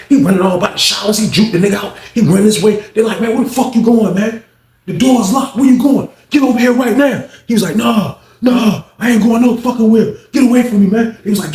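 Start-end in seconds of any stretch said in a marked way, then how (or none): 3.2-7.2 mock guards yelling. Guards chase inmate round showers. 7.8-11.2 defiant yelling. Retold or imitated. Inmate resists guards.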